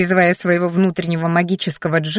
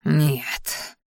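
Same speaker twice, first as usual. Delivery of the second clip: guttural growl; 4 semitones lower, 6 dB quieter than usual. A narrator's reading. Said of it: "утробное рычание".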